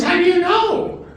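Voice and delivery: high, incredulous question; mock surprised doctor. Doctor asking the cheated husband, high and incredulous. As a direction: ask it pitched high like you can't believe it.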